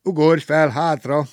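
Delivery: loud, jaunty call-out; cheery driver's call. A narrator's reading. Whistling driver tells hitchhiker to hop on, cheery call. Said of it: "mondta a sofőr fütyörészve".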